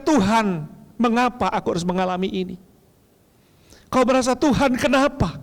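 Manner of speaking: loud, intense preaching appeal; pastor's loud closing altar call. Acted. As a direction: call them forward with fervor.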